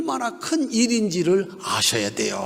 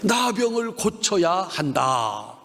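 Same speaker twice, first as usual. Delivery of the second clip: slow recitation; 2 semitones lower, slower than usual. Shown as a demonstration. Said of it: "한번 따라합시다".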